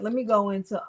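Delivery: ordinary talk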